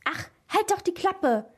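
high-pitched, irritated outburst; acted woman's cross yell; sudden angry yell. A narrator's reading. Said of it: "schrie die andere Frau urplötzlich".

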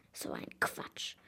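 murmured under his breath; muttered. A narrator's reading. Old man mutters bitterly at the villagers' gossip. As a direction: grumble it into the beard. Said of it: "murmelte er undeutlich in seinen Bart".

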